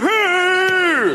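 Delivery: high, long wailing hurry. Retold or imitated. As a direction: wail it like a distant rock singer. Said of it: "it's really far away sounding".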